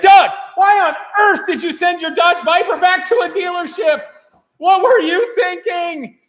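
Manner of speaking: high, loud disbelieving call-out, hammed up; mock outraged shout. Host yells at Doug over the dealership, mock outrage. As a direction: yell at him like it's a crime.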